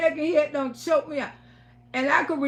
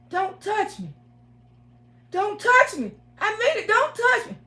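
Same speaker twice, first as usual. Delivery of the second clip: rising refusal; 8 semitones higher than usual. Retold or imitated. Waking woman refuses the boyfriend who choked her.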